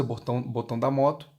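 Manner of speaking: ordinary talk, nothing marked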